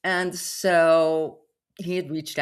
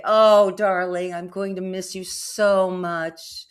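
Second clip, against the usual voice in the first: slow, louder, hammed-up sweetness; mock tender; 4 semitones higher, 7 dB louder, slower than usual. Teasing the scammer with fake love, hammed-up tender. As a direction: lay the fake affection on thick. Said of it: "play with him a little bit".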